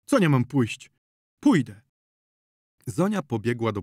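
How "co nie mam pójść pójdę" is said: huffy consent. A narrator's reading. Zonia gives in loudly.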